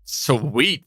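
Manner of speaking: ordinary delivery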